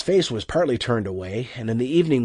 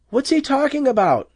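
nervous question; 3 dB louder than usual. A narrator's reading. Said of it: "Margaret asked nervously".

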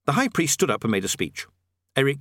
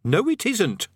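higher, testy contradiction; irritable retort, pitched up; snapped; 11 semitones higher, slower than usual. A narrator's reading. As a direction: snap back at a know-all. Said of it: "snapped Rincewind".

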